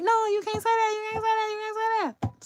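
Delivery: high, loud scolding repeated; telling a toddler off, sing-song; mock scolding parent. Parents correcting the toddler's cursing, high and loud. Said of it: "we be like".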